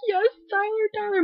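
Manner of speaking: high, thrilled fangirl squeal of his name; excited squeal. Retold or imitated. Fan seeing Tyler return on screen, squealing happily.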